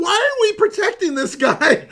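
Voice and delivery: high and loud, laughing through the question; incredulous laugh on the question; laughing disbelief. Retold or imitated. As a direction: ask it half-laughing, unable to believe it. Retelling his own disbelief, laughing.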